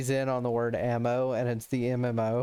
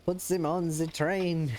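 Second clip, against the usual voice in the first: mock German accent; 4 semitones higher, slower than usual. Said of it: "He's like".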